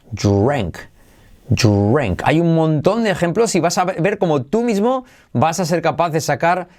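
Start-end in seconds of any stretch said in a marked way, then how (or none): 0.1-2.2 drank said as dru-ank, U glide after the R. Shown as a demonstration. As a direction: slip a short U in after the R of drank. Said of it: "poner una U detrás". (drank con una U tras la R)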